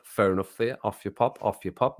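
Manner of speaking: normal talking voice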